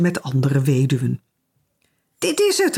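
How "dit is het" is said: high-pitched excited cry; calling out. A narrator's reading. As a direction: call it out high, as a find. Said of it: "riep Cora". (hoog uitgeroepen)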